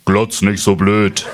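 low, slow scolding kangaroo; schimpfendes Känguru. Acted. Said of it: "schimpft es". (tief und langsam schimpfendes Känguru)

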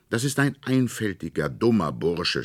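a hint of a brash little brother; scornful boy. A narrator's reading. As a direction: sneer at the toy like a cheeky boy. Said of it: "sprach Fritz".

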